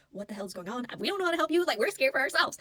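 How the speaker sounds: terrified onlookers. Retold or imitated. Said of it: "they were terrified".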